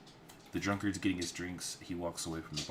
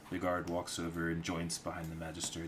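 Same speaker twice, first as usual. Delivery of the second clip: ordinary talk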